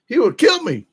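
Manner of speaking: high-pitched disbelief, hammed up; exaggerated high incredulous exclamation. Real, not acted. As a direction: repeat it in amazement, pitch leaping way up. Repeats threat in disbelief.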